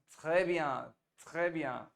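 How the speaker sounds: "très" said with a clear tr and open è, slowed; corrected très. Shown as a demonstration. Teacher corrects the student's très, slowly. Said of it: "not très bien".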